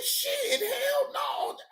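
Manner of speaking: high, frightened refusal; mock scared squeal. Refusing to join the panel, high mock-scared squeal. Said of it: "I say".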